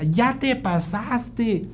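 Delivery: high, drawn-out yell without volume; mock child's yell. Son protesting at dad's pouring, high mock yell. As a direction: imitate a kid crying out in protest, stretched. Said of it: "me grita".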